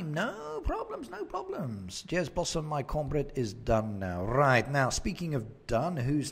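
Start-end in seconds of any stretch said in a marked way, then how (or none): 0.0-2.0 high sing-song. Acted. Brushing off a viewer's apology.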